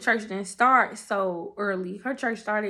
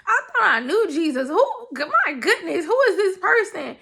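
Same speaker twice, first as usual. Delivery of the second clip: laughing; 7 semitones higher than usual. Retold or imitated. Amazed by the sermon.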